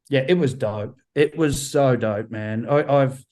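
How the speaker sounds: ordinary talk, nothing marked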